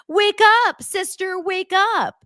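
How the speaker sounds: loud, high mocking call of wake up sister; sarcastic mimic of a sister waking her. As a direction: ham up a frantic wake-up shout.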